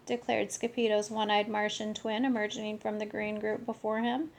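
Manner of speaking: ordinary delivery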